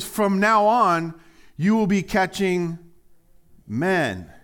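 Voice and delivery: slow, loud, weighty declaration; Jesus proclaiming. Acted. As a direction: announce it like a promise. Pastor voices Jesus proclaiming.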